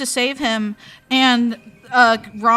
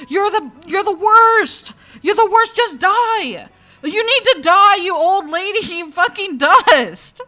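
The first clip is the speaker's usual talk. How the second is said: loud, high frustrated rant; dragging out fucking dust; 7 semitones higher, 6 dB louder than usual. Real, not acted. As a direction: tear into the character. Ranting at the leader cat.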